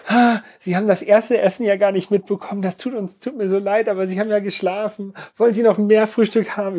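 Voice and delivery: high, sweet, overly polite; a bright apologetic stewardess voice; polite flight attendant. Retold or imitated.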